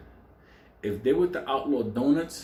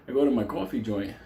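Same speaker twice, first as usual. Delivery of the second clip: ordinary delivery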